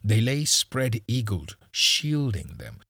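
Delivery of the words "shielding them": plain reading voice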